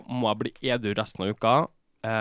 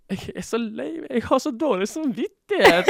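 high, teary mock sob voice of Trude; imitating crying friend; 13 semitones higher, 3 dB louder than usual. Mimicking Trude crying to her friend, high teary voice. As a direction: pitch it way up like a friend crying in guilt. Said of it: "Hun bare begynner å grine".

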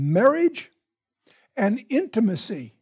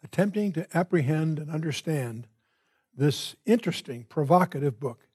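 quiet, unhurried prayer voice, a little softer than his teaching; 4 semitones lower, 3 dB quieter than usual. Acted. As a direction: pray earnestly to the Father before the lesson. Opening prayer before the study.

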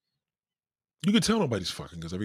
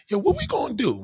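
clueless friday whine; 6 dB louder than usual. Retold or imitated. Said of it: "Y'all be like".

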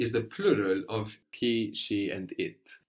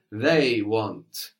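"they" hit louder, then "want" falls away; stress on they; 5 dB louder than usual. Shown as a demonstration. Teacher showing a student how to stress "they": firm. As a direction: lean on "they". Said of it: "you're emphasizing the word for they".

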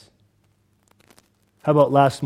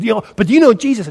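higher, louder, rapid-fire mock interrogation; in-your-face challenge; 6 semitones higher, 5 dB louder, faster than usual. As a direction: push the question right at them, imitating. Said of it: "he just was, like, in my face".

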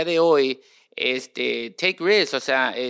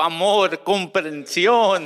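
mock longing; 4 semitones higher, 6 dB louder, much slower than usual. Jokingly listing what he needs.

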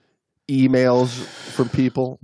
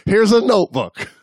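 higher-pitched pretend schoolteacher orders; mock teacher command; 8 semitones higher, 3 dB louder, slower than usual. Husband explaining it to his wife like a class, mock teacher voice. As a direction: give pupils a playful, emphatic instruction.

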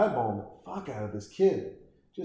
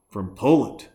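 ordinary delivery

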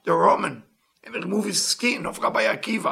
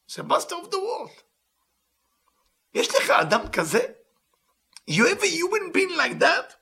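higher, voice catching with real emotion; overcome with emotion; 11 semitones higher than usual. Real, not acted. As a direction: let the awe break through your voice. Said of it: "Sorry, I'm a bit emotional about this part".

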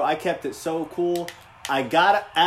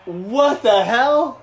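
slow, loud, higher disbelief; drawn-out loud high surprise at the trivia; amazed; 7 semitones higher, 7 dB louder, much slower than usual. Real, not acted. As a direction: stretch it out in shock. Reacting to two-sport fact.

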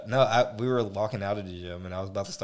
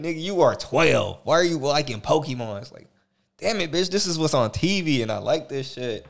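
louder, higher playground back-and-forth; hammed-up schoolyard squabble; mock kid argument; 6 semitones higher, 7 dB louder than usual. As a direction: bark back like a defensive twelve-year-old. Voicing kids teasing, his comeback.

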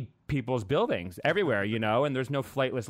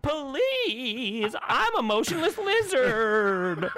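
high, hammed-up pleading voice; pleading lizard; 9 semitones higher than usual. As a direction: beg the Supreme Court like a fragile little creature. Voicing the endangered lizard pleading.

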